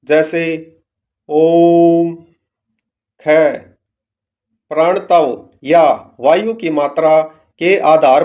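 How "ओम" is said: ओम with the vowel held three times as long; prolonged pluta vowel. Shown as a demonstration. Teacher demonstrating a pluta vowel: drawn-out ओम. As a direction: stretch the ओ in ओम out long and steady. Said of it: "तिगुना समय लगता है".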